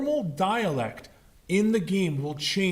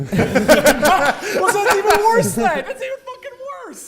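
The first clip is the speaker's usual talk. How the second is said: talking through big laughter, higher; laughing; 13 semitones higher, 9 dB louder, much slower than usual. Real, not acted. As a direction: laugh the words out, much higher. Cracking up at joke.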